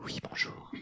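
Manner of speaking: whispered caller; secretive caller whispering to the hotline. Acted. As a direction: whisper so nobody overhears. Caller not wanting to be heard.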